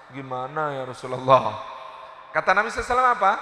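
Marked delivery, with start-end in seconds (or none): 0.1-1.6 remorseful wife. Retold or imitated. Regretful Aisyah asks the Prophet.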